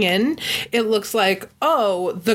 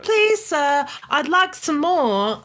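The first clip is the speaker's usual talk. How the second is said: high, slow begging orphan voice; Oliver Twist child; 8 semitones higher, slower than usual. Retold or imitated. Host mimics a British child begging for more food. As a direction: plead like a Dickens orphan. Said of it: "I think that's what it was".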